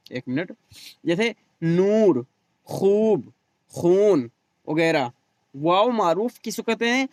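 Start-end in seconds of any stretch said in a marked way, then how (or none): 1.6-4.3 long drawn-out oo in "नूर, खूब, खून"; slow teacher's "नूर" with a stretched oo; stretched waw. Shown as a demonstration. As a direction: hold the oo in each word, "खूब". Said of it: "उसे खींच कर पढ़ा जाए".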